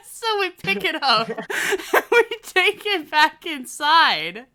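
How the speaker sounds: loud, high, helpless laughter through the words; laughing while talking. Real, not acted. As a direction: crack up as you tell it. Cracking up while telling story.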